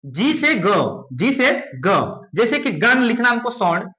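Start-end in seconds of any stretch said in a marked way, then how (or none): 0.1-2.3 जी से ग said slowly and clearly; slow drill. Shown as a demonstration. Teacher drills the letter sound slowly. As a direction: spell out जी से ग slowly for pupils. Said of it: "next आपका है".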